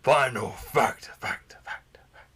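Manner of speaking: whispered, fading echo. Acted.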